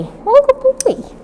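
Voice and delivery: put-on What a good boy squeaked very high; high praise. Shown as a demonstration. Trainer praising the puppy, very high pitched. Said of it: "the higher pitched, the better".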